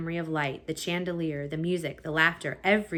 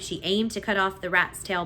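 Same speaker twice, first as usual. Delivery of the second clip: usual speaking voice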